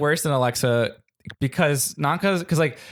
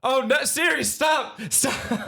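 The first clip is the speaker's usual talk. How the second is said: mock panicked plea; 12 semitones higher, slower than usual. Panicked family yelling at Siri.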